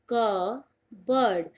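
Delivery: "कबर्ड" as KUB-erd, slow, p silent; drawn-out कबर्ड with no p; correct pronunciation. Shown as a demonstration. Teacher to students, slow correct cupboard. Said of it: "English में बोलते हैं कबर्ड".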